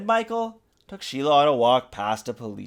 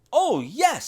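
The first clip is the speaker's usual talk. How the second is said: put-on gleeful girl; 13 semitones higher than usual. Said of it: "said Sheila".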